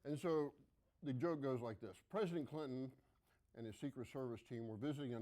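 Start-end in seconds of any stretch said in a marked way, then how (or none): none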